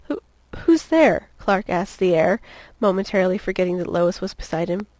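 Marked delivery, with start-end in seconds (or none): 0.1-1.3 high, slow, halting challenge; stammered, startled question. Acted. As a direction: call out uncertainly to an unseen presence. Clark startled by unseen voice.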